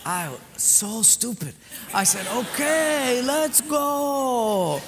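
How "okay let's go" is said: high, drawn-out eager agreement; mock gung-ho. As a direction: stretch both phrases, playing the eager fool. Stupidly agrees to go.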